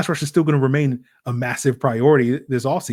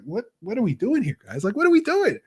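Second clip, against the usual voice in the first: incredulous; 8 semitones higher than usual. Retold or imitated. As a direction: pitch climbing to a high disbelieving squeak. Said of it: "And I'm just like".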